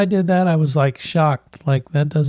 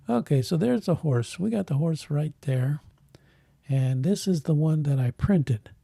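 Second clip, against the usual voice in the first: ordinary delivery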